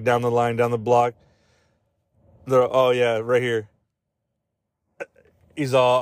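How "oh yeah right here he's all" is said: normal talking voice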